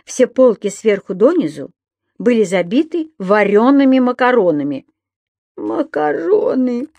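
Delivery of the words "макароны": high, slow moan of dismay; drawn-out acted wail; groaning. A narrator's reading. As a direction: groan it out in disbelief. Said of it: "простонал господин Пепперминт".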